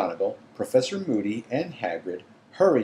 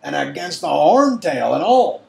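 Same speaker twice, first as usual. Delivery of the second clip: hoarse excited giant; 8 semitones higher, 10 dB louder than usual. A narrator's reading. Hagrid voiced, overjoyed.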